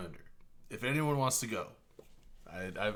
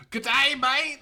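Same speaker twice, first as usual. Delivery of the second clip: mock Aussie greeting; 10 semitones higher, 4 dB louder, slower than usual. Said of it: "He's like".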